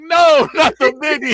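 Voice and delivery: loud, high, drawn-out wail through laughter; mock protest. Voices Greg refusing to lose records.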